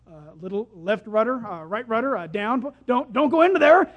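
calm directions building to a loud warning; mounting urgency. Retold or imitated. God steering him away from trouble, mounting urgency.

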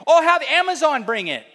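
high, loud mock retort; hammed-up, high-voiced comeback; mock objector. Mocking an imagined objector.

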